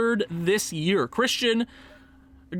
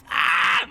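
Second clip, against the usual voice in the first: short harsh "ah"; sudden groaning yelp; frustrated cry; 4 dB louder than usual. Real, not acted. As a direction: bark out a burst of exasperation. Asked to sweeten the deal.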